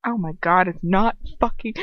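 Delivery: ordinary delivery, nothing marked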